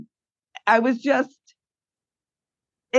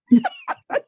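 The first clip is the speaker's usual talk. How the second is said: laughing cry. Real, not acted. Laugh-cry after retold retort.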